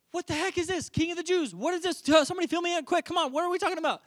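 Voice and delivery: high-pitched, exasperated questioning; frantic demand. Acted. Herod to the gathered rulers, frantic for answers. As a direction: fire off bewildered questions in a high voice.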